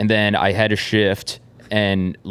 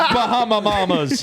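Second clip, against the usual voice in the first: high, slow laughing mock; 10 semitones higher, slower than usual. Mocking laugh.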